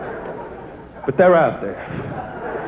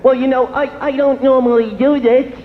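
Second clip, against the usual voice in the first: higher, coy excuse voice; woman making a coy excuse; mock coy woman; 6 semitones higher than usual. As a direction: say it like a faked demure excuse. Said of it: "they come up with all their excuses".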